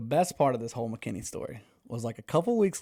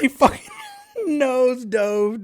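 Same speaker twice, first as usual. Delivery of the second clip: high, loud squeal through laughter; real cracking up mid-story; helpless laughter; 13 semitones higher, 11 dB louder, much slower than usual. Real, not acted. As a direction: barely get the words out for laughing. Cracking up telling the fall.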